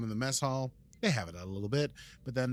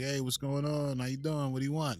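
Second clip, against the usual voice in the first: Brooklyn grocer; 4 semitones higher than usual. Retold or imitated. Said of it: "go to any grocery store in Brooklyn and they'll be like".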